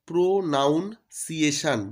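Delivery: "প্রোনাউন্সিয়েশন" in three parts, pro, noun, ciation; broken pronunciation. Shown as a demonstration. Teacher showing students a spelling trick, "প্রোনাউন্সিয়েশন" broken as pro-noun-ciation. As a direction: stretch "প্রোনাউন্সিয়েশন" piece by piece with a full "noun" in the middle. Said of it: "যদি আমরা বলি".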